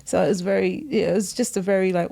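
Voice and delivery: ordinary talk, nothing marked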